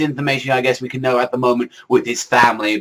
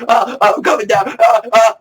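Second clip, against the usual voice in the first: mock scream; 9 semitones higher, 4 dB louder than usual.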